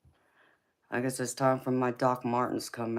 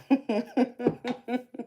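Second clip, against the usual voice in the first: real laugh; 12 semitones higher, 4 dB louder than usual. Real, not acted. Laughing at viewer's reply.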